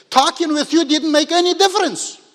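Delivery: loud, high-pitched plea in Moses' voice; protesting loudly. Retold or imitated. Moses arguing with God that he cannot speak, loud protest. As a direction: raise pitch and volume in protest.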